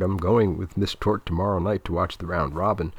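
usual speaking voice

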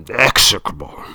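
put-on loud, German-accented pronouncement; German director, finding the word. A narrator's reading. Director searching for the correct word, disdainful German accent. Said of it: "he searches for the correct word".

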